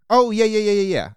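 light up, getting it all at once; excited realization. Real, not acted. Sudden realization.

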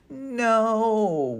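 high, drawn-out whiny no; whining. A narrator's reading. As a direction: whine like a toothache. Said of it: "whined Danny".

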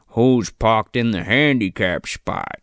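low, slow mock scoutmaster; scoutmaster impression. Scoutmaster walking into the store, low and slow mock sternness. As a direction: draw it out like a stern tough guy. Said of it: "the scoutmaster comes in and he's like".

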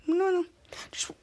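ordinary talk, nothing marked

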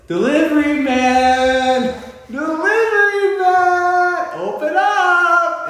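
high, loud, slow calling out; kid's call. Retold or imitated. Son knocking on locked door, loud high slow call. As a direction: bellow it through the door like a child. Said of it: "And he goes".

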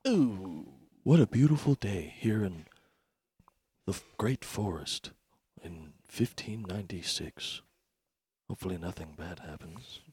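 hushed, soft fake reporter; quiet announcer voice. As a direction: murmur it like a secret. Announcer bit, muttered.

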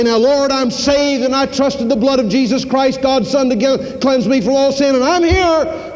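high, bright proud claim; eager believer proudly reporting to the Lord; eager self-assurance. Acted. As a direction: announce it like you've earned your place. Imagined arrival in heaven.

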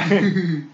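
laughing so hard it hurts; hearty laugh. Real, not acted. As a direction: belly laugh. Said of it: "my jaw hurts from laughing".

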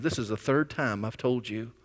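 mock anger held low and quiet; understated, tight-reined annoyance in a low voice; retold anger, softly and firmly laid down; angry. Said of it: "he gets very angry".

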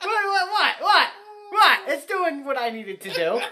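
high, loud, defensive what; mock outrage. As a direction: act indignant about the old phone. Mock outrage over phone.

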